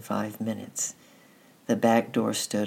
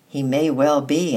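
grim; 3 semitones higher, 7 dB louder than usual. A narrator's reading. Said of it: "rather grimly".

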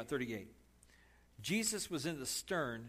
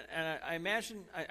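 ordinary talk, nothing marked

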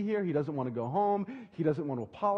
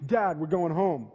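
louder, slow and firm, flatly insisting; stubborn son; 2 semitones higher, 5 dB louder, slower than usual. Retold or imitated. Son refuses the walk, louder slow stubborn insistence. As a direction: lay down the law like a sulky kid.

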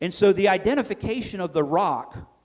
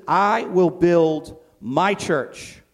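I will build my church, very slow and louder; slow emphatic declaration; 5 dB louder, much slower than usual. Retold or imitated. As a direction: spell out each word of the promise. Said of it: "and he says".

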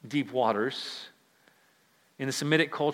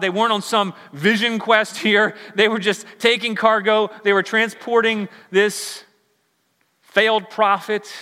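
louder, higher, driving sermon voice; full-force preaching; 6 semitones higher, 6 dB louder than usual. Acted. Preacher pressing the point on the congregation, full force.

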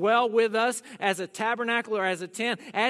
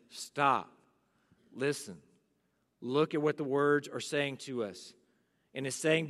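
ordinary delivery

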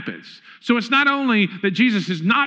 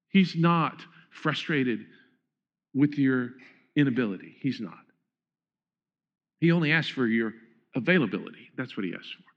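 reassuring; 7 semitones lower, 5 dB quieter than usual. Acted. Soft reassuring sermon passage.

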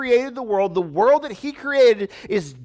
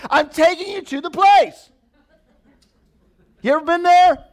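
high, loud mock rant; exasperated parent; 11 semitones higher, 7 dB louder than usual. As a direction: keep the fed-up parent going. Parent ranting at kids.

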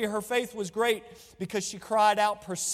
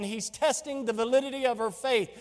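ordinary talk, nothing marked

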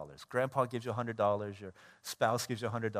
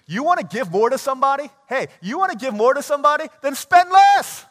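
much louder and higher, full-force call to the crowd; preaching shout; 12 semitones higher, 17 dB louder than usual. Real, not acted. Preacher's climactic challenge to congregation.

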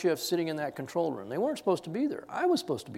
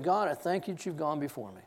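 quiet prayer; 3 semitones lower, 7 dB quieter than usual. Retold or imitated. Prayer in the truck.

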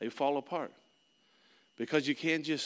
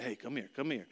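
put-on much softer, coaxing call to a hurt child; soothing parent; 3 semitones lower, 9 dB quieter than usual. Parent to crying child on the driveway, soft and soothing. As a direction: gently beckon the little one.

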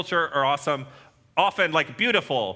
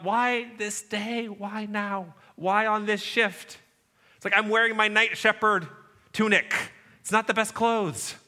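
high-pitched, bewildered protest; plaintive shepherd; 5 semitones higher than usual. Acted. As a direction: whine lightly, as if caught unprepared. Shepherds question being chosen on their shift.

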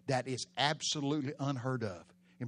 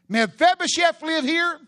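high, loud, trembling call of a frightened messenger; 17 dB louder than usual. Acted. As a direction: cry it out high and shaking. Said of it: "in a trembling voice".

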